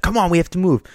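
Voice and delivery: mock an impatient yell without the volume; retold yell. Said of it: "he started yelling".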